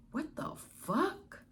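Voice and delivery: high, hushed and stunned; shocked disbelief. Retold or imitated. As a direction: drag it out in disbelief. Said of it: "And we was just like".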